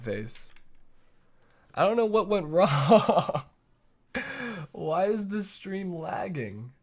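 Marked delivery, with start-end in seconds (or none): 1.7-6.7 slow, higher-pitched whiny lament; mock crying. Streamer lamenting lag to viewers, slow mock-tearful whine. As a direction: break into a hammed-up sob as you complain.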